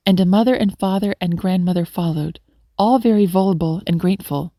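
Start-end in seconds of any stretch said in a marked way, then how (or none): none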